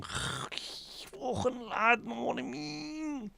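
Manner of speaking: very high, slow whispered thick Scouse; mock a thick Scouse accent in a breathy squeak. Mocking how thick Scousers sound, very high whispered Scouse. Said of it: "you hear me going".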